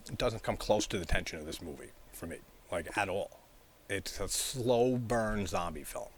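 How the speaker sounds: ordinary delivery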